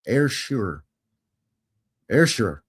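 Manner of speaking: "Ayrshire" said as "air-sher", first part like air, ending like sure; slow and careful: "Ayrshire" as air-sher; correct Ayrshire. Shown as a demonstration. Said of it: "It's pronounced Ayrshire".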